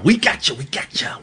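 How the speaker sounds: a mock-secret whisper fading right down to nothing; hushed teasing whisper to the caller; playful whisper. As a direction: whisper it teasingly under the breath.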